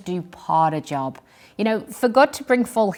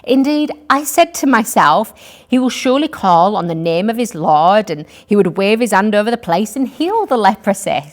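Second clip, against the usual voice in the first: louder, indignant scripture reading; mild fury; 2 semitones higher, 6 dB louder than usual. A narrator's reading. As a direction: read it as an affronted complaint. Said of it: "Naaman became furious and went away".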